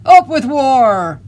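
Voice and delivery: big, high, drawn-out war cry; shout. A narrator's reading. Mean tyrannosaurus pair calling for war, shouting. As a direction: shout it loud and long. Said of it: "And they shouted".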